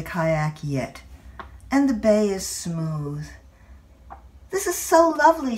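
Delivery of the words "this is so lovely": high, fast and louder, full of delight; the old mother gushing over the lovely bay. Retold or imitated. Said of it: "she says".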